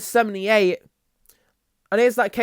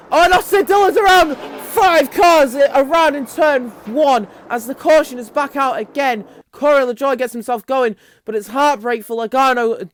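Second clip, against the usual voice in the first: excited live commentary on a pile-up; startled crash call; 5 semitones higher, 6 dB louder than usual. Real, not acted. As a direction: burst into a high, loud shout, then ease off. Calls a sudden crash.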